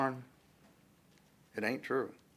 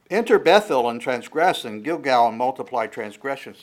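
emphatic scripture reading; 8 dB louder than usual. Acted.

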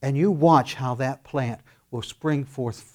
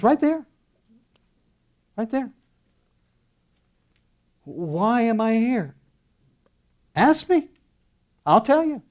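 God answering; 9 semitones higher than usual. Acted.

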